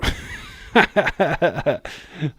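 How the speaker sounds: bursts into a high, loud laugh; a sudden delighted laugh; real laugh. Real, not acted. Laughing at chat's heel turn idea.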